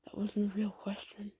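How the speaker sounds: breathy whispered mumble under his breath; whispered aside. Acted. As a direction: whisper it, not meant to be heard. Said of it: "you just hear him mumble that".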